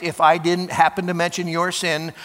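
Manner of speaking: normal talking voice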